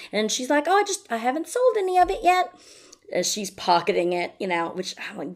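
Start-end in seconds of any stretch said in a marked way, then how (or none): 0.7-2.5 very high, sweetly evasive mock voice; feigned innocence. Woman stalling swindler over his money, feigned innocence. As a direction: play dumb in a high voice.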